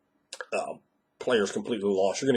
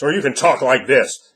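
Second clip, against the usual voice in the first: louder, projected voice; raised level; 6 dB louder than usual. Shown as a demonstration. Game master demonstrating a change of level, loud and projected. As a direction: push the volume up. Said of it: "everyone can change the level they're talking at".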